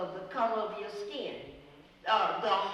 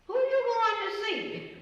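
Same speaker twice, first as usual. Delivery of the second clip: much higher, louder, curious question; 14 semitones higher, 4 dB louder than usual. Retold or imitated. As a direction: ask it with eager curiosity. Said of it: "I said".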